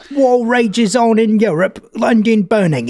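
British accent. Shown as a demonstration. Host retries the headline in a British accent. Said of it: "can I do it British".

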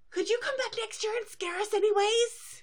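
put-on high, eager kid's plea; excited little boy voice. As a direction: beg like an excited colt.

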